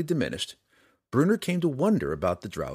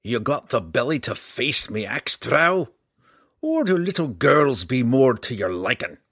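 louder gruff dwarf mockery; dwarf mocking the drow's courage; taunting dwarf; 3 semitones higher, 4 dB louder than usual. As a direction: goad him with contempt.

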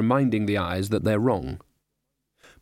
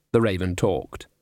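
ordinary delivery

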